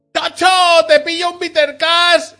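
high, loud mock yell; shouting in the street. Woman calling out outside the supermarket, shouting. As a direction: holler it across the street, hammed-up.

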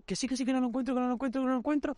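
imitated panic. Girlfriend panicking over stolen phone.

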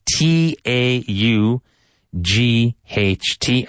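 slow, high, each letter apart; spelling letter by letter. Shown as a demonstration. Teacher spells the word letter by letter for students. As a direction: spell it out slowly. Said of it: "spelling out letters".

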